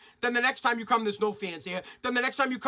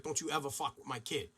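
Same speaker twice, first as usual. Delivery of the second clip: low, much softer warning; quiet threat; 9 semitones lower, 11 dB quieter than usual. Retold or imitated. Father warning the man who hit his son, quiet threat. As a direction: murmur it like a dad's private threat. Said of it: "my dad went over and was like".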